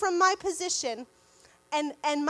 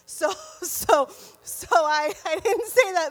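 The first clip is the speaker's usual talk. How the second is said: louder, voice wobbling between laugh and tears; teary laugh; 6 dB louder than usual. Real, not acted. Telling her group about the doctor, teary and laughing. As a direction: laugh it off while choked up. Emotional retelling of the doctor visit.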